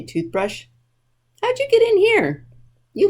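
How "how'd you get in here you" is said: mock surprise. Librarian finds toothbrush in room.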